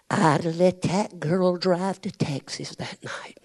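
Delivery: whispered, slow confession. Real, not acted. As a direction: breathe it softly, slow. Emotional aside to congregation.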